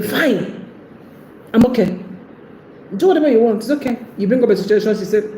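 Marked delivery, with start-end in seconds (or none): 0.0-4.0 higher, clipped upset reassurance; upset fine. Retold or imitated. Woman insisting she's fine to her man, higher and upset. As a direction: pretend to be okay while plainly hurt. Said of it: "when she's saying".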